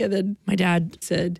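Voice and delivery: plain reading voice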